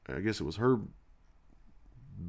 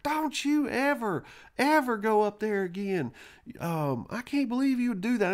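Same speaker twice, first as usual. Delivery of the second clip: put-on scolding; 11 semitones higher than usual. Grandma putting on a show for the neighbor, high mock scolding. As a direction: fake a furious whipping scold, high. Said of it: "she was like".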